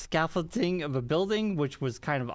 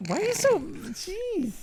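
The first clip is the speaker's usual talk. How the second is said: exasperated; slower than usual. Real, not acted. Exasperated at Mark's snipe.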